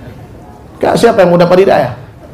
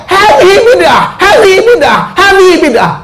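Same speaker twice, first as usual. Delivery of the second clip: Abu Jahal shouting; 13 semitones higher than usual. Retold or imitated. Abu Jahal on a rock yelling at the Prophet, shouting. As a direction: cry it out from the rock like a villain. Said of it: "Dia berteriak".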